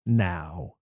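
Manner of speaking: now, dropped low and set apart after a pause; stressed now. Shown as a demonstration. Teacher instructing actors, stressed low now. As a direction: lean on now, low and final. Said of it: "I instruct actors to always stress the word".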